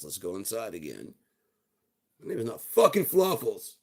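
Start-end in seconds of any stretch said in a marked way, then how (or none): 2.2-3.8 "not fucking Fluffles" much higher and louder; the dog furiously rejects the name; indignant outburst. Acted.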